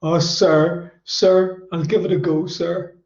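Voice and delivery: high, mock-tearful voice; imitating tearful student. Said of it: "and he's still crying".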